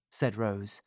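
plain reading voice

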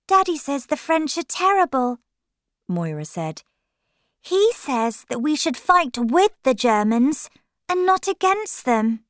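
put-on little girl innocently repeating her father; Moira's child voice. Girl tells Linda what Daddy thinks, in a high childish voice.

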